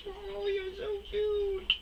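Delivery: very high, slow squeaky coo; high-pitched slow cutesy coo. Real, not acted. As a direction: gush over something adorable in a tiny voice. Cooing at something cute.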